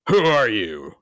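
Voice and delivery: a hint of a growled demand; a touch of gruff Batman. Batman turning to face the masked gunman, gruff. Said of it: "He's just like".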